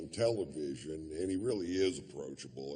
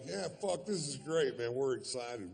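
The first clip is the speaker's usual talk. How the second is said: higher, eager yes; excited; 9 semitones higher than usual. Retold or imitated. Comic accepting the yacht party invite, thrilled. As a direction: gush like you won the lottery. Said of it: "So I was like".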